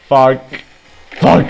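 loud frustrated swearing; sharp loud curse. Real, not acted. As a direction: spit it out, angry. Taking damage.

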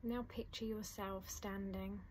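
soft, unhurried meditation voice with long pauses; guided meditation. Acted. Teacher guiding listeners through a letting-go meditation, soft and calm. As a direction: ease the listener gently into calm.

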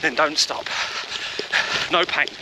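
ordinary talk, nothing marked

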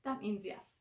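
usual speaking voice

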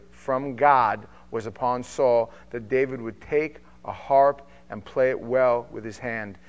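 measured, steady Bible reading; formal reading of scripture aloud. Acted. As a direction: read the passage with weight, slowing on key lines.

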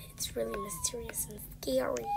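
hushed, spooky whisper; mysterious whisper. Pretend. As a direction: whisper it as if it's scary. Building mystery about Dot.

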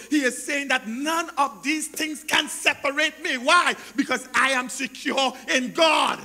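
loud, driving sermon delivery; impassioned preacher in full flow; full-force preaching. Real, not acted. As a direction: proclaim it forcefully to the congregation.

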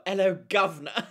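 playful stage-British hail; mock British accent. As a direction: put on a jokey British accent. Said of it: "How British of you".

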